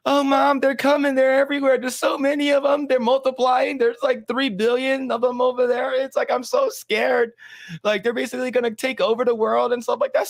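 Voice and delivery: put-on high, shouty frightened kid voice; scared Cartman. Cartman telling his mom, high frightened voice. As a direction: whine it out high, terrified. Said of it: "And he's like".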